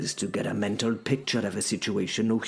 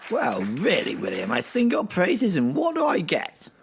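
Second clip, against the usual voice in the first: much higher, louder, wounded courtier; offended Percy; 12 semitones higher, 5 dB louder than usual. Acted. Percy protesting to William after being told to shut up, indignant and high. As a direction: whine up high like a slighted fop.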